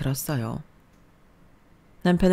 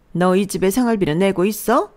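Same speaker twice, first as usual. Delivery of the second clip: a louder, bossy mother-in-law lecturing her daughter-in-law; mother-in-law putting the new bride in her place, a touch louder; scolding mother-in-law; 4 semitones higher, 6 dB louder than usual. Acted. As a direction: lay down the law like a domineering mother-in-law. Mother-in-law demands rent money.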